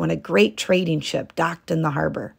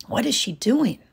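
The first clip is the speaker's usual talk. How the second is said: higher, puzzled; wondering; 6 semitones higher than usual. A narrator's reading. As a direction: ask it to himself. Osamu wondering.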